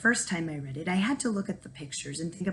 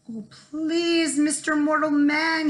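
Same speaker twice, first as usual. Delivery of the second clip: high, pleading little voice; 8 semitones higher, 4 dB louder, slower than usual. Acted. Captured leprechaun pleads with Jamie, pleading. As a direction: beg for mercy. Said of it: "he pleaded".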